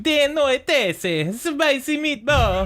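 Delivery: high, slow hammed-up Italian accent; mock Italian. Joking with cohost in mock Italian, high and slow.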